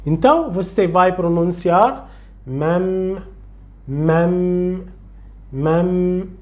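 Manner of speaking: mem with the second m held long through the nose; doubled m demo. Shown as a demonstration. Teacher showing the doubled m to the student, slow hum. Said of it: "O alongamento está no segundo som".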